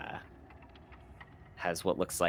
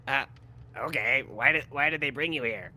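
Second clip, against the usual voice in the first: higher, louder wary stranger voice; character voice; 9 semitones higher, 10 dB louder than usual. Acted. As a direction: play a startled stranger behind a door. GM voices the hidden NPC.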